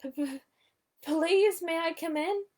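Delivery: high stuttering plea; timid stammer. A narrator's reading. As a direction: stammer the request nervously. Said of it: "Piglet said".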